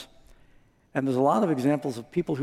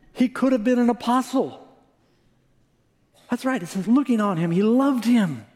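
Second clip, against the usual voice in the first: high, loud, building emphasis; preacher stressing that Jesus loved him, high and loud; fervent preaching; 9 semitones higher, 3 dB louder than usual. Real, not acted. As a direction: lift the congregation with it. Preacher rising in fervor.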